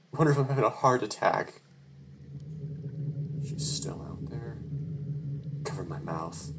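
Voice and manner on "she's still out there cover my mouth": unvoiced, much softer hushed warning; whisper. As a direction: breathe it so no one hears. Hiding, whispering.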